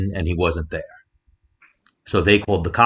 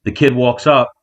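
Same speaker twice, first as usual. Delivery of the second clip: usual speaking voice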